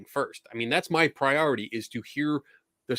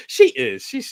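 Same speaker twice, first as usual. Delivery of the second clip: laughing; 13 semitones higher, 6 dB louder, slower than usual. Real, not acted. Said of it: "She's a sweetheart".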